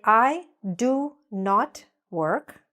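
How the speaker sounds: slow, spelled-out "I do not work"; slow, clear model sentence. Shown as a demonstration. Teacher models the full negative form slowly. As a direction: model it slowly for learners to copy. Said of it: "So we say".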